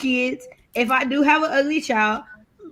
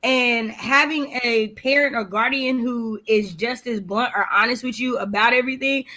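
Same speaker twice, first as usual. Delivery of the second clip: ordinary delivery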